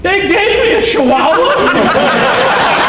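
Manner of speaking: high, loud, slow squeal of dismay through a laugh; a stunned blind man hearing his guide dog is a Chihuahua; shocked disbelief. Acted. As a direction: stretch it out as if truly shocked to learn it. Blind-dog bluff exposed, punchline.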